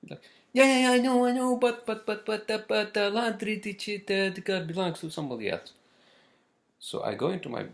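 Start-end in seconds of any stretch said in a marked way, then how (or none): 0.5-5.7 put-on very high, stammering Spanish-accented lady; thick Spanish accent. Laundry lady insisting it is another's laundry, imitated Spanish accent. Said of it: "in her thick Spanish accent".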